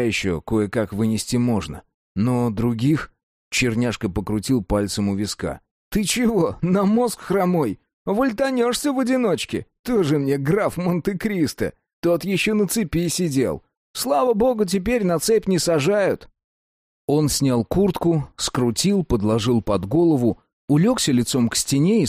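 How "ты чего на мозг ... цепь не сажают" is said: lightly voiced streetwise prison con; a hint of a jailhouse old-timer; convict voice. Cellmate convict talking.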